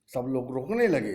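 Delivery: normal talking voice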